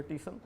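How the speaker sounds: soft "टीशन" said tishan; Bihari देहाती उच्चारण; rustic pronunciation. Shown as a demonstration. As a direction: drop "टीशन" quietly as tishan. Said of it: "अनपढ़ लोग जो है".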